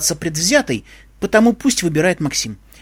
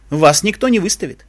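plain reading voice

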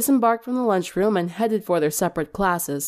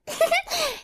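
giggle; 3 dB quieter than usual. Acted. Pinkie after belching.

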